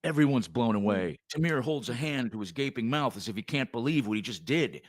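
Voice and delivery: usual speaking voice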